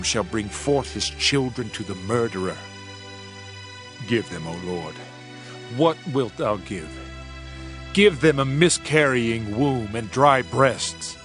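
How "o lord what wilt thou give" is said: normal talking voice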